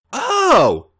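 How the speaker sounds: a sudden loud high Oh; surprised yelp. A narrator's reading. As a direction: let it jump out of you, caught off guard. Sudden realization mid-touch.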